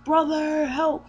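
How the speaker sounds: high, loud falsetto cry for help; mock panicked yell. Princess yelling for her brother at an intruder, high mock panic. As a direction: shriek for your brother in a put-on panic. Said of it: "she's yelling".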